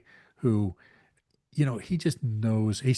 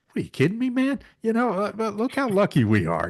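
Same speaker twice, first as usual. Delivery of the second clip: much higher, louder, incredulous guy voice; Rob Lowe impression; 13 semitones higher, 8 dB louder than usual. Podcast host imitating Rob Lowe mocking the Rat Pack, high and loud. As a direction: scoff at the whiners in a big high voice. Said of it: "he was like".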